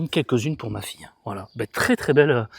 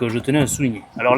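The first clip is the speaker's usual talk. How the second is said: ordinary talk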